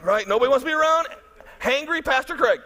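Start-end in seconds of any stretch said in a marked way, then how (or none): none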